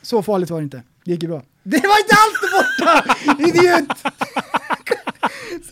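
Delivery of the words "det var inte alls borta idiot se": high, loud laughing yell; laughing loud outburst. Real, not acted. Friend laughing loudly at cohost's half-peeled mask, high and loud.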